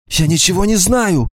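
high, gasping frightened denial; a frightened woman out of breath; breathless whisper. Acted. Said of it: "задыхаясь, прошептала она".

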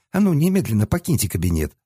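put-on high, indignant young woman; sharp girlish scolding; angry girl. A narrator's reading. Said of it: "гневно смотрела".

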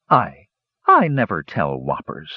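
mildly indignant denial, hesitating on the first word; a bit indignant. A narrator's reading. Storyteller accused of telling whoppers, a bit indignant. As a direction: protest with a touch of offence. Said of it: "a bit indignant".

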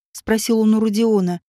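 plain reading voice

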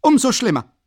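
very high, loud dismissal; furious shout. Acted. Raskolnikow schreit Luschin an, er solle verschwinden. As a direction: bellow him out the door.

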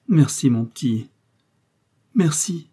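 mildly tearful, pitch lifting at the end; a restrained touch of sobbing gratitude; tearful thanks. A narrator's reading. Mother thanking her son, understated tearful thanks. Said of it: "elle sanglota".

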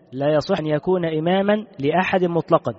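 ordinary talk

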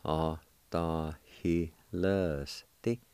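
very slow, drawn-out Autahilesti; slow syllable by syllable. Shown as a demonstration. Teacher slowly demonstrating Autahilesti to learners. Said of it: "It's pronounced like this".

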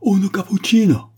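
very high, loud terrified cry; extreme fright. A narrator's reading. Spanish grandee pointing at the door, terrified shout. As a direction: shriek the word out in dread. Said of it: "en témoignant une frayeur extrême".

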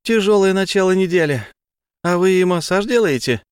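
put-on high, loud cheerful girl; chatty eager young woman; Tamara voice. A narrator's reading. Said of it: "с радостью поведала".

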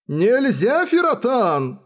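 high, urgent forbidding cry. Acted. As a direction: call out to stop someone. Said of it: "крикнул он ей".

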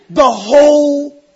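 slow, loud, laughing through the words; laughing punchline. Real, not acted. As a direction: crack up as you land the joke. Punchline of brother story.

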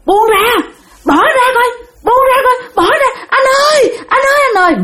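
imitating panicked, high-pitched pleas to let go; acted frightened cry. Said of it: "mẹ mới la lên, mẹ kêu rằng".

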